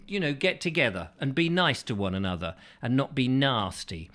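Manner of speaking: usual speaking voice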